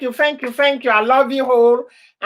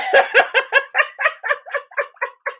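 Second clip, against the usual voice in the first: real delighted high laughter; high burst of laughter; 13 semitones higher than usual. Real, not acted. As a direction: crack up at the memory. Laughing while saying bye.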